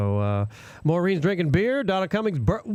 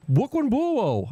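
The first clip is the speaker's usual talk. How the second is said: put-on drawn-out, slow Brooklyn accent saying Buo for borough; Brooklyn accent imitation; 6 semitones higher, much slower than usual. Teasing a coworker by imitating her Brooklyn accent. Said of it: "Linda from the Brooklyn Buo, that's how she talked".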